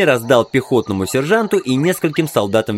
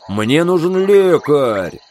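raised, drawn-out call for help; yelling; 4 semitones higher, 3 dB louder, much slower than usual. Acted. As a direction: shout for a medic across the column. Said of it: "заорал Билл". (протяжный крик, зов лекаря)

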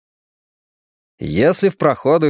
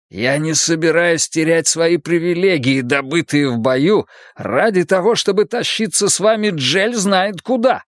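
higher, indignant refusal; indignant growl; 6 semitones higher than usual. A narrator's reading. Prisoner unwilling to lose privileges won in battle, angry protest. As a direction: refuse angrily, pitch climbing as it goes. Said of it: "прорычал он".

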